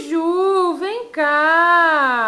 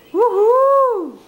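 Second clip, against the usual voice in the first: loud high whoop of joy; excited cheer; 6 semitones higher, 5 dB louder than usual. Real, not acted. Mother cheers toddler's answer.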